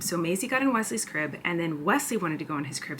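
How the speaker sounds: normal talking voice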